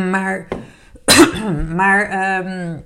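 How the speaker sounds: clearing throat after a sip; throat still wet from a drink; after sipping a drink. Real, not acted. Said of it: "Ik moet even een slokje drinken pakken".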